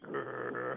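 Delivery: low, mock-menacing growl; imitated grumpy growl. Comedian imitating his scary French teacher, low mock growl. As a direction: growl like a very serious ex-con. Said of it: "Danielle was very serious, too. He was always like".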